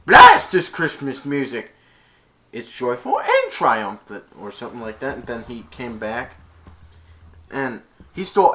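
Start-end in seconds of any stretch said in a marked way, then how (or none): 0.1-4.2 loud, grumpy Grinch shout; Grinch outburst. Retold or imitated. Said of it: "he went".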